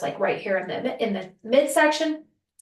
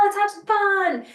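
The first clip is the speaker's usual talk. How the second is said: put-on playful kid; 9 semitones higher than usual. Child self quoting her inner voice.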